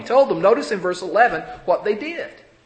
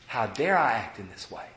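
low, soft self-reproach; indignation at oneself; 7 semitones lower, 7 dB quieter than usual. Acted. Repentant believer questioning his own conduct, quiet indignation. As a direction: rebuke yourself in a lowered, softened voice. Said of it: "Indignation is".

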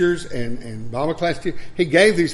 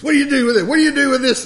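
much higher, louder mock demand; angry old man; 12 semitones higher, 8 dB louder, faster than usual. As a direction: mimic him waving the fly swatter. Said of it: "And he says".

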